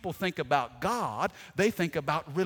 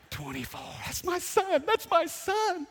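high, proud singsong mom voice; 10 semitones higher than usual. Retold or imitated. Mom showing her button at the arena, high and proud. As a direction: crow it like a proud mama.

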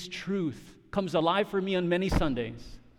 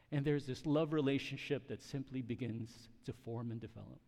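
hushed sermon appeal; 2 semitones lower, 8 dB quieter than usual. Real, not acted. Preacher urging church to receive Jesus's words, hushed. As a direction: let it sink in, hushed with long pauses.

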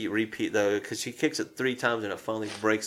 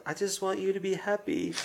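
put-on pleading child; 8 semitones higher, 6 dB quieter than usual. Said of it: "he's just like".

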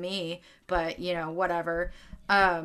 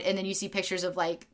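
normal talking voice

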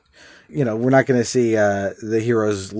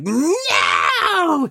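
high shouted no; villain shout; 14 semitones higher than usual. Retold or imitated. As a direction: yell it like a cartoon villain. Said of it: "He doesn't talk that way".

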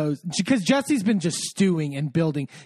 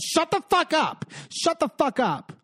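acted-out quarrel, pitched way up; retold angry outburst; 9 semitones higher than usual. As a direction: imitate someone losing it in an argument. Retold quarrel, Jesse snapping at Luke.

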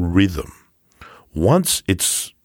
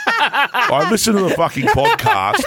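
put-on a light English accent through a laugh, slower; 11 semitones higher, slower than usual. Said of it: "in that beautiful English accent".

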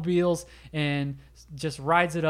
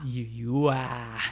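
slow cheesy romance; 3 semitones lower, much slower than usual. Retold or imitated. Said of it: "he's like".

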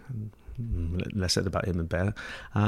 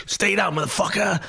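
mock threat, pitched much higher; retold thug threat, hammed up; tough street guy; 11 semitones higher than usual. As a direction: bark it like the guy with the whip. Bullwhip man repeating threat.

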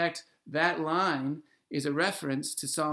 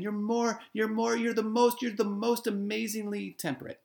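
higher, building into playful over-the-top praise; mock gushing; 7 semitones higher than usual. Poet teasingly flattering his beloved, mock gushing. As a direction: pile on the compliments like a smitten poet.

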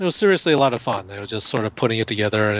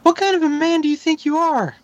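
high put-on girl voice reading the movie line; girl's voice. Shown as a demonstration. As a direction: pitch way up and deliver it like a girl. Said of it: "who can do a girl's voice?".

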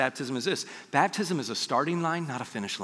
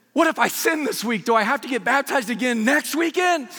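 high, louder worried questioning; mock panic; 9 semitones higher, 5 dB louder than usual. Newly baptized lady asks the pastors, mock panic. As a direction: imitate a new believer in a comic fluster. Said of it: "this look of terror on her face".

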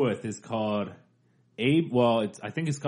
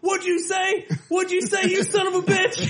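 much higher, hammed-up furious shout; John Wilkes Booth shout; 3 dB louder than usual. John Wilkes Booth yelling back at the heckler, high shout. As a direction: scream it back up at the balcony. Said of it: "And it's John Wilkes Booth".

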